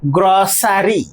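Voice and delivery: গ্রসারি as three syllables, gro-sa-ri; common mispronunciation. Shown as a demonstration. Teacher showing students how they usually say it, common mispronunciation. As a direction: say গ্রসারি with a full "sa" in the middle. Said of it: "আমরা কিভাবে উচ্চারণ করি".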